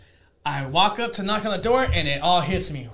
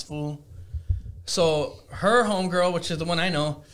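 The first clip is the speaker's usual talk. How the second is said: ordinary talk, nothing marked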